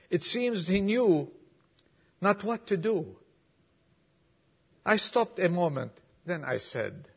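preacher reciting a moving poem to the congregation; poem recital. Acted. Reads a whole poem aloud.

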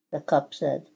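plain reading voice

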